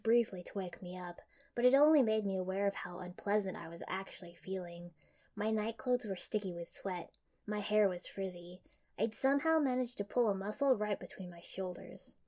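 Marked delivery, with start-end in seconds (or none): none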